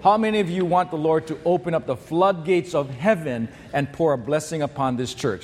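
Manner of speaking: ordinary talk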